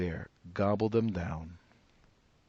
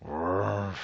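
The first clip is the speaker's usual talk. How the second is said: bear growl; 3 dB louder than usual. Acted. Bear growling at the woman opening the door. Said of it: "growled Old Bear".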